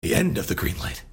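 low grunting Solid Snake growl. Pretend. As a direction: grunt it like a gruff soldier. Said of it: "we can just grunt in snake voices".

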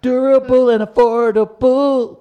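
loud, upbeat commercial voice; ad read. Pretend. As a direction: sell it like a radio spot. Hype man ad read.

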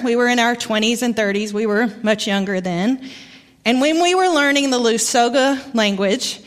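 ordinary delivery

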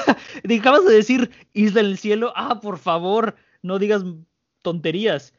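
imitated derisive disbelief; laughing mockery. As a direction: scoff like a bar crowd hearing nonsense. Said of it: "Se ríen, se cagan de risa".

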